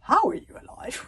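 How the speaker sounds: astonished, high-pitched question; baffled. A narrator's reading. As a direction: ask it loudly in bewilderment. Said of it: "Stoic's next baffled question".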